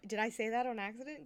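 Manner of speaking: ordinary talk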